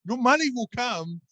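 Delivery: ordinary talk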